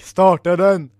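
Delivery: loud, forceful order; full-voiced shout to get going; shouted command. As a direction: bellow it to the crew. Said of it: "och skrek".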